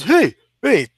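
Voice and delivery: a touch of a stiff Long Island tough guy; Long Island accent. Retold or imitated. Long Island accent, fighter calling to a passerby. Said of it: "Long Island accent".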